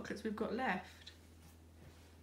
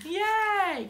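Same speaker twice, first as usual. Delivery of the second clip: excited; 10 semitones higher, 8 dB louder than usual. Real, not acted. Child gives ten out of ten.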